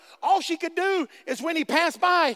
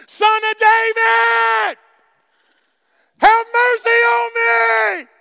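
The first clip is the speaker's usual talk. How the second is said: drawn-out, high screamed plea; crying out all the more, very loud and long; Blind Bartimaeus scream; 9 semitones higher, 11 dB louder, much slower than usual. Retold or imitated. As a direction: scream it slowly at the top of your voice. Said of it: "That was my blind Bartimaeus scream".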